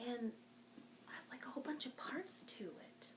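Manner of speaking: soft, hushed describing of a hidden object; mystery whisper. Real, not acted. Librarian feeling a mystery object for kids, soft whisper. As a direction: whisper the clues like a secret.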